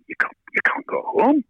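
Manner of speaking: mock disbelief, half-whispered and high; retold disbelief. Bandmate stunned he must leave.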